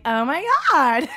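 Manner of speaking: put-on Valley Girl. Picking up girls' talk by accident, Valley Girl. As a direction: slip suddenly into Valley Girl pitch. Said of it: "suddenly say something like".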